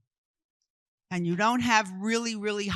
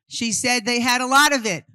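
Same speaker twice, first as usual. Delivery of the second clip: raised, louder voice for the room; loud relaying; 3 semitones higher, 8 dB louder than usual. Real, not acted. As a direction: call it out so everyone hears.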